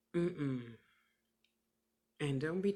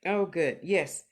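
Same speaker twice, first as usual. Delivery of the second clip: ordinary delivery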